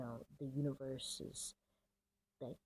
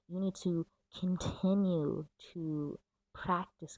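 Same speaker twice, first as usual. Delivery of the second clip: slow and louder, leaning on continue; slow emphatic advice; 2 semitones higher, 6 dB louder, slower than usual. Real, not acted. Reader firmly tells viewers to keep practicing, slow and emphatic. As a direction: stress each word as a firm instruction. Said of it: "emphatic slow advice".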